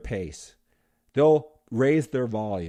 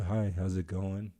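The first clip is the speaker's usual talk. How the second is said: low-key greeting; 6 semitones lower, 6 dB quieter than usual. Retold or imitated. Quiet low-key greeting example.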